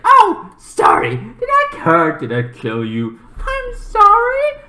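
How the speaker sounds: high, slow sing-song fake apology; mocking villain. Killer sister taunting her victim, high slow sing-song mockery. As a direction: sneer out a sweet false sorry.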